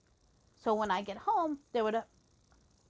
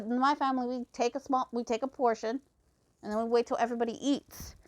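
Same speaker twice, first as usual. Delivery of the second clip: ordinary delivery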